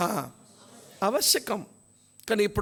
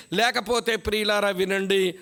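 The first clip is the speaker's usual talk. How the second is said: ordinary talk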